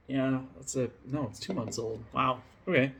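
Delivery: usual speaking voice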